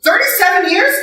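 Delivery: high-pitched astonished echo; squealed amazement; amazed. Real, not acted. Amazed at audience member's marriage.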